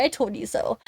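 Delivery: a tucked-away little gloat; smug told-you-so. Pretend. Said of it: "so I can say".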